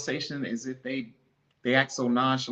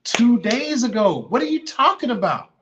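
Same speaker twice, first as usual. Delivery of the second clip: disbelief; 4 semitones higher, 5 dB louder than usual. Retold or imitated. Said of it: "I said, I said".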